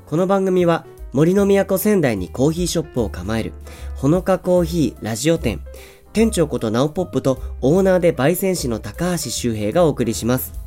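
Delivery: upbeat radio announcer opening; radio host intro. Acted. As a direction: read it like a show opener. (ラジオ番組のオープニング口調)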